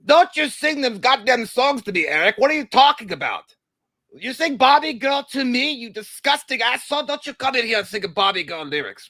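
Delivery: high, loud indignant rant; mock outrage. Host scolds [name] for the Barbie Girl message, in mock outrage. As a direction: scold in hammed-up fury.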